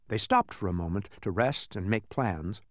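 usual speaking voice

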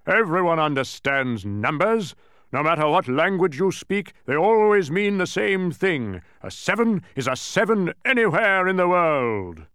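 loud, high emphatic tirade; bellowing. A narrator's reading. Mathematician insisting numbers are universal, bellowing. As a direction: bellow it out, stretching the last words. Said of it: "bellowed the mathematician".

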